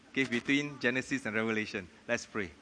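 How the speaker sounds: ordinary talk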